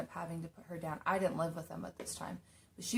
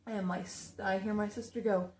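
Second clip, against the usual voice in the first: usual speaking voice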